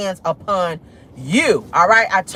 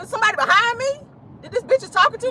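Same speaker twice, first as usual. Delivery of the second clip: high disbelief; 13 semitones higher, faster than usual. Retold or imitated. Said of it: "cause I'm like".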